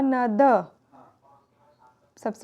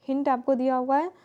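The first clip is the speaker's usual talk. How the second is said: usual speaking voice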